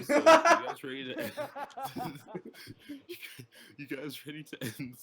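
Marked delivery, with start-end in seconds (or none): 0.0-1.3 laughing, high and loud, barely getting the words out; laughing while asking. Real, not acted. Streamer asking friends to end the stream, cracking up. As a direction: giggle through the question.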